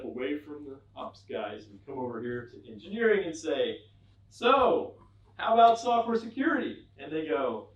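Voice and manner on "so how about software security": higher, louder, eager ask; bright question. Retold or imitated. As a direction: chirp it hopefully. Asking engineers brightly.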